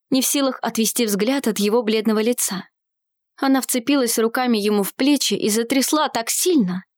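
plain reading voice

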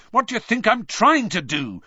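loud, high-pitched exasperated snap; angry. A narrator's reading. Harry retorting to Hermione during practice, angrily. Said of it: "said Harry angrily".

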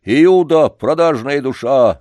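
loud, slow furious cursing; old man swearing at the janitor, loud. Acted. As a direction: hurl the insults at him. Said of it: "выругался Лодыжкин".

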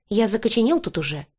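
ordinary delivery

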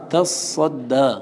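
تصدى drawn out slowly as tassadda; slow تصدى with a doubled sad; Nafi's reading. Shown as a demonstration. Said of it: "تصدى بالتخفيف".

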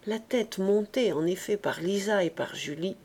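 plain reading voice

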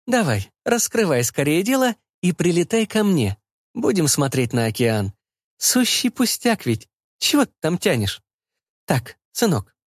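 lightly voiced pushy mother, rising in pitch; impatient mother. Mother urging son to finish the case, impatient. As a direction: hurry the son along as his mother.

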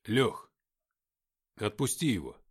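firm, insistent plea; demanding request. A narrator's reading. Ignat asking his friend to release Arkaim, demanding. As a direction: ask it as if it were an order. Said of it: "голос прозвучал настолько требовательно".

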